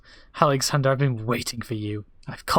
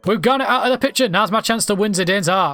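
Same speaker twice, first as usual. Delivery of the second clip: put-on high, loud, eager girl; bubbling over with a schoolgirl crush; excited little girl voice; 8 semitones higher, 4 dB louder than usual. Young girl plotting a love letter, high and eager.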